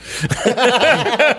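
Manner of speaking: high, bright burst of laughter; real laugh. Real, not acted. Laughs at his own story.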